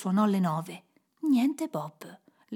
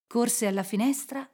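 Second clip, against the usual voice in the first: plain reading voice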